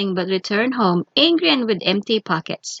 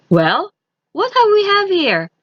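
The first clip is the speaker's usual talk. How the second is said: surprised stranger; 10 semitones higher, 4 dB louder than usual. A narrator's reading. Stranger sees driverless cart.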